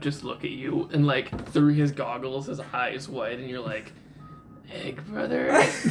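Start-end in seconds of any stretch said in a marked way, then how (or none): 4.7-5.9 high, slow, breathy disbelief; incredulous. Acted. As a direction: say it like you can't believe it. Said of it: "Don't like the way you said that".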